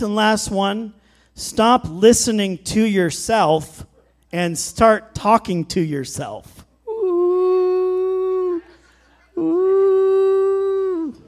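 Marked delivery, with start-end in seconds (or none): 1.3-6.7 slow emphatic. Shown as a demonstration. 6.9-11.1 high ooh. Real, not acted. Congregation reacts to the point.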